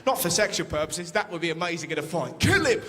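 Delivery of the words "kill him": shout. Pretend. Fight scene shout.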